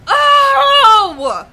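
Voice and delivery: loud, high, cracked furious cry; real rage, near tears; angry yelling. Real, not acted. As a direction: blow up at the screen. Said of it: "So sorry I'm getting this mad".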